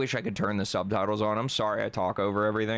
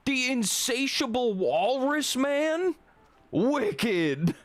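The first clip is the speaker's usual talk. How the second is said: high, slow, delighted exclaiming; gleeful excitement; 14 semitones higher, 4 dB louder, much slower than usual. Real, not acted. Streamer thrilled by the insatiable walrus man, gleeful high cry. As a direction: relish the silly name with glee.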